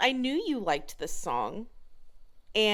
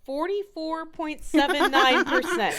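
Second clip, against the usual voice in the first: laughing; 7 semitones higher, 8 dB louder than usual. Real, not acted. Announcing the surprising low grade.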